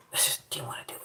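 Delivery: breathy whispered repeat; whispered admission of laziness. Real, not acted. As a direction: drop it to a secret whisper.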